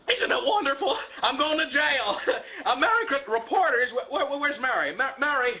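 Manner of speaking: laughing, overjoyed excitement; giddy delight. Acted. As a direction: gush as if bad news were wonderful. George giddy despite arrest.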